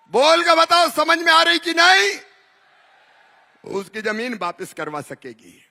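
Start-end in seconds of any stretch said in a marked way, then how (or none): 0.1-2.2 shouting, higher, to the whole crowd; booming political rally speech. Real, not acted. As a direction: demand an answer from the crowd.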